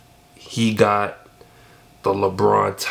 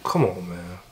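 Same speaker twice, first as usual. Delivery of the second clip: ordinary talk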